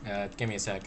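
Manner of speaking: a hint of a soft, low robot; calm robot character. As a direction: speak quietly as a laid-back robot. Voices game characters chatting.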